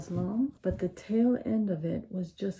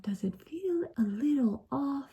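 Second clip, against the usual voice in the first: whispered worry; 6 semitones higher, 3 dB quieter, slower than usual. Retold or imitated. Wondering about her haircut.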